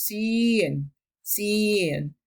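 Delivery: sien with a long ie, slow; German pronunciation. Shown as a demonstration.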